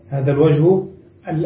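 ordinary talk

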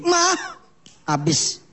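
normal talking voice

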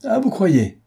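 cri moqueur bien plus aigu et plus fort; défier Holmes d'une voix haute; crié. Acted. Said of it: "cria Peters". (cri narquois, voix très montée)

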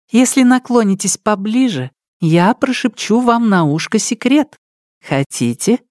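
high, coaxing playful voice; sly young lady. Acted. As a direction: lure him closer with a secret. Nelidova coaxing. (лукавый девичий голос)

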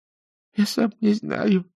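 high, slow weepy whine; tearful. A narrator's reading. Interrogated creature doesn't know what it is, tearful. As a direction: wail it slowly as if crying. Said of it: "заплакало существо".

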